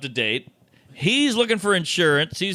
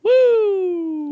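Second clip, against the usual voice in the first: high happy woo; excited whoop; 14 semitones higher, 4 dB louder than usual. Retold or imitated. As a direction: let out a giddy cheer. Joyful whoop in retold drive.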